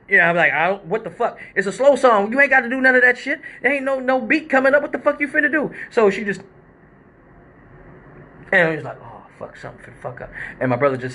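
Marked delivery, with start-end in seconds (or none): 0.5-5.7 alarmed disbelief. Retold or imitated. Alarmed as stripper starts risky move. 9.0-10.3 much lower and softer, under the breath; muttered dread. Retold or imitated. Watching the stripper, muttering a quiet dread.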